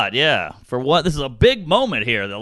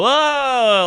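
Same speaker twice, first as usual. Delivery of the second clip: high, loud faked whoa; mock fake whoa; 9 semitones higher, 5 dB louder than usual. Mocking a faked reaction, a high loud whoa. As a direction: fake an over-the-top whoa. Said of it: "He didn't fake it and go".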